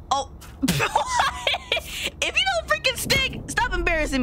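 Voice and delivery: very high, squeaky exasperation; exasperated squeal. Real, not acted. Player scolding a piece that won't stick, exasperated squeal. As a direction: squeal it in frustration.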